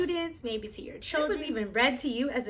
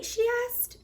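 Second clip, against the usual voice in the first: ordinary delivery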